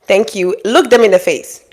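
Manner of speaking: usual speaking voice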